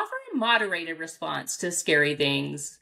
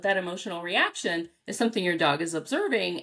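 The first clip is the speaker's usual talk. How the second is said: normal talking voice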